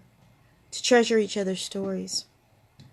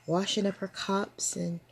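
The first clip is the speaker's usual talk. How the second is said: ordinary delivery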